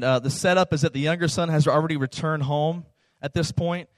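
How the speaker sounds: ordinary delivery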